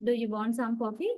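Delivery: "coffee" rising at the end; rising question intonation. Shown as a demonstration. Teacher showing a yes-no question expecting an answer, "coffee" rising. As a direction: ask for a reply, pitch climbing on "coffee". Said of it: "my pitch level goes gradually up".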